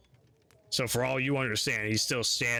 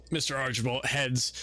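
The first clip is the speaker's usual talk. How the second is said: usual speaking voice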